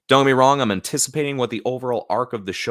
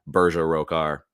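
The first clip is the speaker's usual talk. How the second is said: plain American accent with a hard final r; 3 semitones lower, 3 dB louder than usual. Shown as a demonstration. Streamer showing the name as gibberish, American accent. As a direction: lower American accent, r's pronounced. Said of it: "say it in just an American accent".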